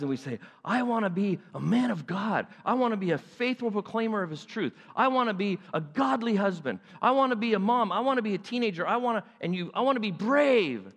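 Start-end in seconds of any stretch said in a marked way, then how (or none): 0.6-9.3 higher-pitched eager vows, piling up; excited declaration. Retold or imitated. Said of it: "really excited about who God is". 9.7-10.9 high, drawn-out zeal on brave; excited declaration. Retold or imitated. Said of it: "really excited about who God is".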